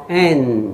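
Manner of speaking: "N" held through the nose, lips apart; nasal N. Shown as a demonstration. Teacher models the letter through the nose, open-lipped "N". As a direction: end "N" in a nasal hum without closing the mouth. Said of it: "嘴巴不能合哦".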